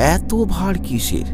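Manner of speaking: ordinary delivery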